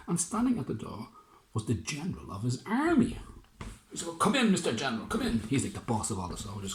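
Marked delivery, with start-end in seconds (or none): 4.1-5.4 quick, eager welcome; fussy mayor. Acted. Mayor hurrying the general inside. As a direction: usher him in quickly.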